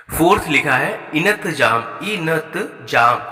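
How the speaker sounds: इनतजाम stretched very slowly, each syllable apart; drawn-out wrong word. Shown as a demonstration. Teacher to class, slowly sounding out the misspelt इनतजाम. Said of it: "इनतजाम नहीं होता".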